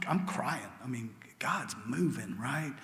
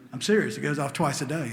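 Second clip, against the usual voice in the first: much louder, earnest insistence; emphatic insistence; 3 semitones higher, 11 dB louder than usual. Real, not acted. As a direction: raise your voice to insist you mean it. Insists he really does this.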